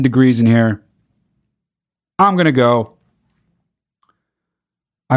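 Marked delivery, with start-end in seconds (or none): none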